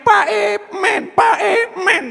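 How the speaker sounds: put-on high-pitched begging toddler voice; mock childish pleading; whining small child. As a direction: whine for candy like a little kid. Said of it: "Maka anak kecil itu merengek".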